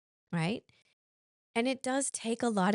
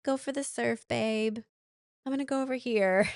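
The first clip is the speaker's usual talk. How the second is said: high, light teasing voice, a touch put on; playful put-on voice; 7 semitones higher than usual. To the partner, sending them off surfing, high and playful. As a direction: breezily shoo your partner off to surf.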